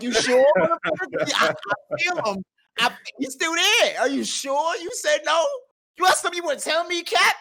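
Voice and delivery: loud, high, suspicious shouting; retold angry grilling. Grilling his homie about the robbery, a loud high angry shout. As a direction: press him hard, shouting. Interrogating friend angrily.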